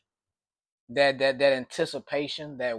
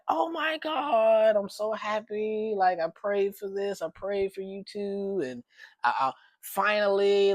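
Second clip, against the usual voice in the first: over-the-top mock joy; 7 semitones higher, 4 dB louder than usual. Friend reading the prank text, hamming up her overjoyed reply. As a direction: gush like a dream just came true. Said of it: "she wasn't that dramatic".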